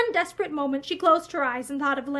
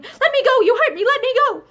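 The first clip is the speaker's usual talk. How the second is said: high, loud sobbing cries; panic-stricken sobbing; 9 semitones higher, 7 dB louder than usual. A narrator's reading. Wife sobbing in panic as her husband hurts her. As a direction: sob out in panic. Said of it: "panic-stricken sobbing".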